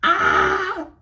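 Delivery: sudden high, loud yelp; a squeal that bursts out. Real, not acted. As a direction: shriek of amused disbelief. Sudden outburst mid-story.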